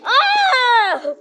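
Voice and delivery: sharp high yelp of pain. Real, not acted. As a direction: cry out as the shot stings. Hit by a paintball, yells.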